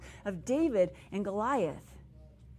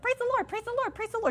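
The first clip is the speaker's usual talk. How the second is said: high, sing-song gushing "praise the Lord"; over-the-top praise friend; 14 semitones higher, 3 dB louder, faster than usual. Retold or imitated. Mocking a friend who is not in reality, pitched way up. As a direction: mimic a gushing churchy friend, much higher. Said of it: "you ever had a friend in your life that's like".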